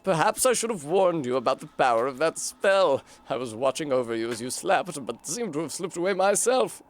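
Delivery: a kindly-sounding but scheming mystic offering a gift; British snail shaman. Acted. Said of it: "I'm British, apparently".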